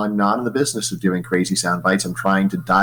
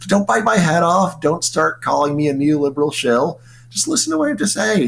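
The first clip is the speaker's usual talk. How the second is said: much higher, pleading and urgent; 8 semitones higher than usual. Retold or imitated. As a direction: beg them to just listen. Pleading with critics.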